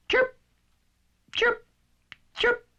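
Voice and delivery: high squeaky bird chirps; robin chirp. Acted. The robin arriving with high chirps. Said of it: "And Chirp said".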